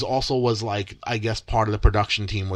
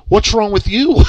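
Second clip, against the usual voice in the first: higher and louder, startled; startled question; 11 semitones higher, 8 dB louder than usual. Retold or imitated. Contestant startled to see the producer sobbing, higher and louder. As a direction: blurt it out, confused.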